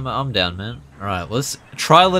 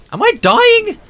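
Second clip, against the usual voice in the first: panicked fish squeak; 5 dB louder than usual. Pretend. Needle stuck in fish.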